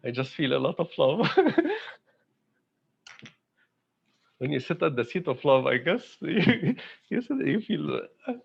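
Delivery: tearful laughing, voice breaking high; hurried words cracking into high, choked laughs; overcome with emotion. Real, not acted. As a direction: struggle to speak through real emotion. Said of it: "I don't know why I'm emotional today".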